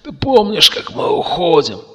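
high, strained dying rasp; wheezing. A narrator's reading. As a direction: croak it with dying effort. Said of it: "прохрипел Макс". (хрипло, на последнем издыхании)

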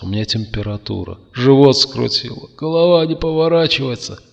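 жалобно, с нарастающей высотой; перечисляй хвори, постанывая; стон больного. A narrator's reading. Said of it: "простонал Кертис". (жалобное постанывание)

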